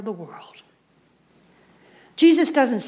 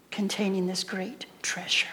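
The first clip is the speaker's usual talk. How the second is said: much softer, breathy whisper of awe; 3 semitones lower, 10 dB quieter than usual. Real, not acted. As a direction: hush it like a secret treasure. Tender point about treasure.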